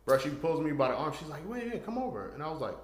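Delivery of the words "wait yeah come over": put-on girl's voice. Girl voiced pulling him over.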